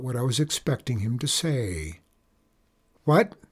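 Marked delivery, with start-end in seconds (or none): 3.0-3.4 snapping. A narrator's reading. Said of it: "I snapped again".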